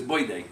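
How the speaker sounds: put-on "Boy day" with D replacing the TH of birthday; Louie. Mimicking Jerry's father's accent, "Boy day" for birthday. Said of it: "And so Louis says".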